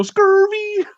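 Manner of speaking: high squeaked "Scurvy"; scurvy for scary. Shown as a demonstration. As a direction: say "Scurvy" as a yelp, the word scary bent out of shape. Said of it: "When someone scares us, we go".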